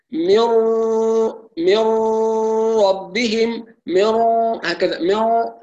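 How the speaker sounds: مر with a long nasal ghunna from the nose. Shown as a demonstration. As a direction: hold the ghunna through the nose. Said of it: "من الخيشوم". (مر بالغنة من الخيشوم)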